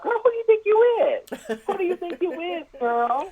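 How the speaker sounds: mock confrontation with a rival housewife, high and sassy; sassy mock challenge. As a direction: toss it at her like a diva's challenge. Caller mocking a housewife.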